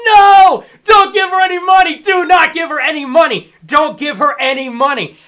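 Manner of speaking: high, very loud, desperate shout; yelling at the screen. Retold or imitated. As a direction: shout it like a frantic warning. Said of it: "But I was watching that like".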